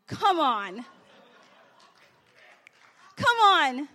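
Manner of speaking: loud preacher's come on, rising; calling out to the crowd; rousing call. Real, not acted. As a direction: fire up the congregation.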